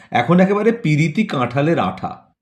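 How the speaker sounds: ordinary delivery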